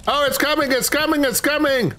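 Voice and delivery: high alarmed repeated warning; panicked. Real, not acted. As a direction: panic as it closes in. Monster approaching in game.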